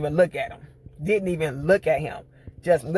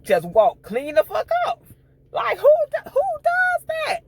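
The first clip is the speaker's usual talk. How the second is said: high, slow, drawn-out disbelief; incredulous; 5 dB louder than usual. Real, not acted. Reviewer amazed that he just walked off, high incredulous exclamation.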